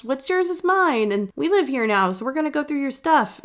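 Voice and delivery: high, sing-song entitled roommates; mock the pushy housemates in a high silly voice. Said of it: "They're very much".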